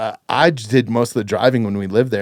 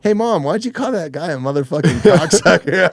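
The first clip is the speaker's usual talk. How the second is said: put-on higher, innocently asking kid voice; young daughter; 7 semitones higher than usual. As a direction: imitate a kid piping up to mom. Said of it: "my daughter chimes off".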